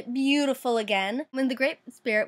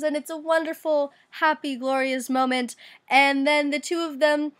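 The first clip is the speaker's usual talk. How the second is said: ordinary delivery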